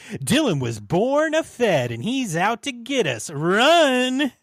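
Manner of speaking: loud, high hammed-up Italian accent rising to a yell; cartoon Italian, building to a shouted warning; mock Italian accent. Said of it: "So I'll go Italian".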